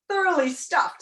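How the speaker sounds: overfed sigh, starting high and loud. A narrator's reading. Count loosening his belt after dinner, heavy sigh. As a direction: sigh it out, stuffed to bursting. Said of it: "sighed the Count".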